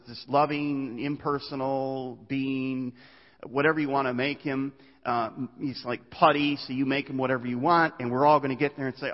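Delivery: normal talking voice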